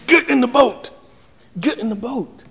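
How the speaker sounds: understated exasperated order; frustrated Jesus. Retold or imitated. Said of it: "is frustrated".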